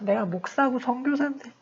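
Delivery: acted inner fretting; troubled self-reproach. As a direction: lament quietly to yourself, voice lifted. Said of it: "또 마음 내내 불편해".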